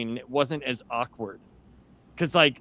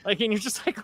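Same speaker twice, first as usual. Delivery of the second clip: usual speaking voice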